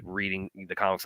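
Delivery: ordinary talk, nothing marked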